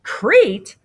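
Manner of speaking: high, loud startled echo; aghast father; alarmed. Acted. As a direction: repeat it in shocked disbelief. Father alarmed at son's plan.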